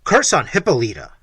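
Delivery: loud curse; Manfred cursing his wife aloud; cried out. Acted. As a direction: spit out the curse with force. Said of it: "cried Manfred".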